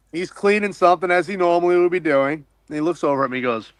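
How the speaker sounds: ordinary delivery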